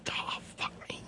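whispered dismay to yourself; barely audible, whispered swearing; exasperated whisper. Acted. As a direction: breathe it out voiceless, in despair. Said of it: "and you go".

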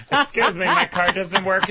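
high, exasperated plea to the attendant; frustrated passenger. Retold or imitated. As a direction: act out a passenger whose card won't work.

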